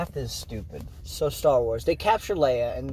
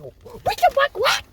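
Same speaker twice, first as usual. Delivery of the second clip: high squeal; 5 dB louder, slower than usual. Retold or imitated. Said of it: "they're like".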